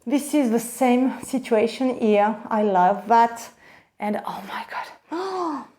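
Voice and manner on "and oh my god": slow, soft, breath-held amazement; hushed awe. Real, not acted. Vlogger overcome by the second bag, hushed awe. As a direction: say it slowly, nearly whispering in awe.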